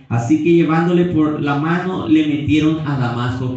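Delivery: loud, high preaching of the scripture; preaching at full force. Acted. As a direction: proclaim it to the whole church.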